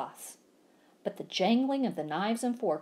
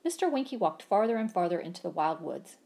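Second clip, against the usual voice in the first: ordinary delivery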